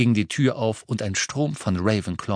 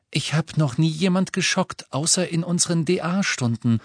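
put-on light, higher-pitched girl's voice, mildly curious; higher, lilting, only moderately interested; 7 semitones higher than usual. A narrator's reading. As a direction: voice Luna musing with mild interest. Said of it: "klang dabei mäßig interessiert".

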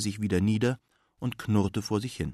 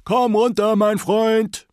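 even higher, loud repeated call; old man calling his dog down a second time, higher still; louder calling up into the sky; 12 semitones higher, 8 dB louder, slower than usual. Acted. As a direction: call again, pushing the voice further up. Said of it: "rief er in die Luft hinauf".

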